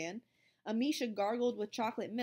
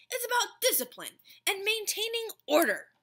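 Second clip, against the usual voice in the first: higher, louder strict monitor voice; stern lecture; 12 semitones higher, 8 dB louder than usual. A narrator's reading. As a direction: lay down the law.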